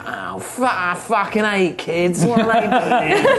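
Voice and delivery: slow disgusted grumble; grumpy Jason. Acted. Jason grumbling about the kids, slow and disgusted. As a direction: sneer at the kids. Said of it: "you just see Jason just like".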